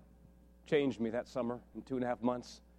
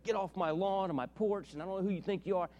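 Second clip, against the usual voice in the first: retold angry yell; 6 semitones higher than usual. Angry homeowner ordering a young preacher off his lawn, mock yelling. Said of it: "Just screaming at me".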